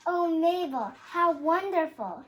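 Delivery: breathless, bright rise of wonder at the sight; amazed delight. A narrator's reading. Madame Bada Bada marvels at the mermaid paradise, amazed. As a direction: gasp it out, thrilled and awed.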